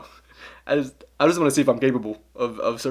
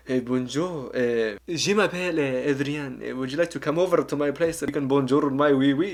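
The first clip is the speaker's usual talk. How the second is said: put-on French accent; 2 semitones higher than usual. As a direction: play a cheesy French flirt with a put-on French accent. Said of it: "you wanna see me try French?".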